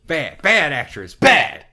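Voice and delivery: loud, rising scolding shouts of bad; mock director yelling. Director yelling at his actress, mock furious shouting. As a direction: bark it like a furious director, climbing.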